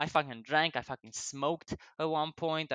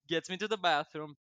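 ordinary delivery

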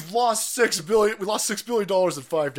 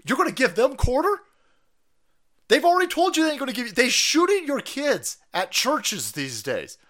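ordinary delivery

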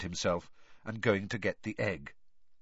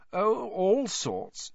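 put-on high, whiny girl ghost; Myrtle's ghost voice; 10 semitones higher, much slower than usual. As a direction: pitch up into a mopey schoolgirl ghost.

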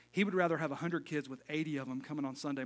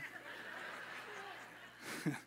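laugh; 10 dB quieter than usual. Real, not acted. Laughing at his own story.

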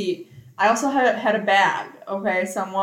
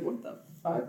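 hushed, near-whispered disbelief; muttered disgust; 6 semitones lower, 11 dB quieter than usual. Retold or imitated. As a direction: mutter it under your breath, imitating a disapproving stranger. Woman's disapproving reaction to greeting.

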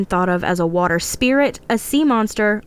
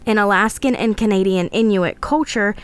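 usual speaking voice

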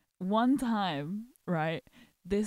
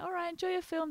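cheery service voice; 8 semitones higher, 4 dB quieter, faster than usual. Retold or imitated. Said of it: "I was like".

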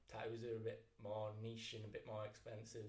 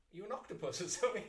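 higher, louder sarcastic jab; mocking retort; 8 semitones higher, 8 dB louder than usual. Tattooist retelling his jab at a greedy customer, mocking. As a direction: needle him with a cheeky put-down.

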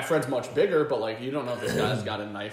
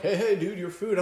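calling after someone; 8 semitones higher, 4 dB louder than usual. Retold or imitated. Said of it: "My buddy's like".

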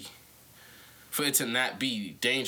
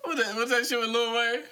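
raised mock quote; 9 semitones higher than usual. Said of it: "[name] turned around and said".

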